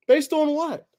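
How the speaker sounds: high-pitched incredulous question. Real, not acted. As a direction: ask it again in pure disbelief. Incredulous rant about rankings.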